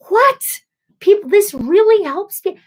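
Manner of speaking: high, loud, disbelieving cry; incredulous. Retold or imitated. Reacting to people who find ASMR soothing, high incredulous voice. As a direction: squeal it in disbelief.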